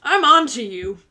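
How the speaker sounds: a playful I'm-watching-you; mock warning. Viewer told to warn their person, loud mock warning. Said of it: "shake your fist at them and say".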